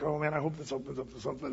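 low, fast mutter to himself; worried muttering. Retold or imitated. Pastor to himself while squeezing into the cave, worried mutter. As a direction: mumble it quickly under your breath.

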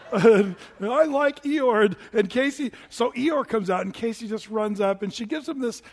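usual speaking voice

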